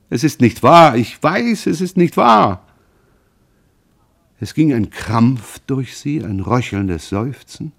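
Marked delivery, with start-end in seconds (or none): 0.1-2.7 much higher and louder, insisting es ist nicht wahr; begging son shouts his denial; desperate loud denial. A narrator's reading. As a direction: cry out against the truth, almost breaking. Son desperately denies accusation.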